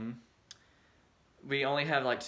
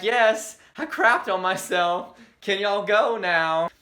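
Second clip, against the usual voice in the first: louder and higher, slow; exasperated yell; 7 semitones higher, 9 dB louder, slower than usual. Retold or imitated. She tells the crowd in the room to leave, loud. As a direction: shout it to clear the room. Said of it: "And I'm like".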